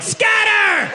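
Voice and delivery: high, long-held scream of alarm; yelled warning. Retold or imitated. Friend warning drunk kids of police, high yell. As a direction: yell it far up in pitch, stretched out. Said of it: "and yelled".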